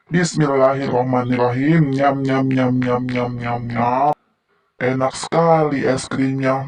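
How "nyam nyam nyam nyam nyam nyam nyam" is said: nom nom chomping. Pretend. Pretends to eat strawberry ice cream.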